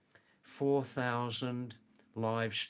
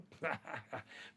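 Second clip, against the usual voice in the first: soft, higher-pitched chuckle; amused chuckle; 4 semitones higher, 9 dB quieter than usual. Real, not acted. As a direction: chuckle softly after showing off. Laughs after dialect demonstration.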